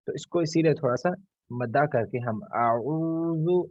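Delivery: normal talking voice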